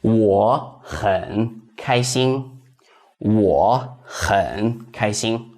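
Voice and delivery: slow, careful Mandarin for learners; slow teacher's demo; slow model sentence. Shown as a demonstration. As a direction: say it slowly, syllable by syllable, for students to copy. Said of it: "So it would be".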